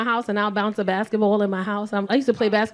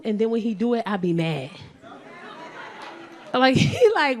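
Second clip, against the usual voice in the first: ordinary delivery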